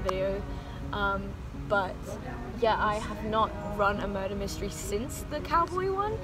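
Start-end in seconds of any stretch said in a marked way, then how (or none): none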